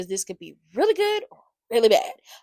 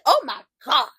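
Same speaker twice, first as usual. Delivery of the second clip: loud excited gasp of words; thrilled disbelief; reacting to Shiloh's promo with loud excitement; amazed; 3 semitones higher, 10 dB louder than usual. Real, not acted.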